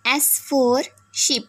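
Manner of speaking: "O for orange" very slow, each word drawn out; slow phonics drill. Shown as a demonstration. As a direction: spell it out slowly for small children. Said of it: "तो एस से स की sound निकलती है". (धीमे-धीमे बच्चों को सिखाते हुए)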